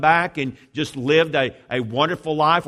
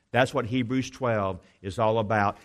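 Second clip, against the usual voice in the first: ordinary talk, nothing marked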